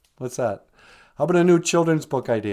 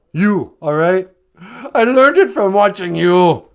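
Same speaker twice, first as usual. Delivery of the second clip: put-on loud, slightly higher defiant kid; 6 semitones higher, 9 dB louder than usual. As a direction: fire it back at the dad like the kid. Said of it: "the kid looks up and he goes".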